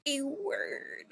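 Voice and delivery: "word" drawn out, high and soft; odd silly voice. Real, not acted. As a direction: say "A word" oddly, high-pitched and stretched. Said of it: "why I said it like that".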